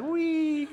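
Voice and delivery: sending freshmen off like sheep off a cliff, playful wee; mock wee. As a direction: toss off a gleeful wee.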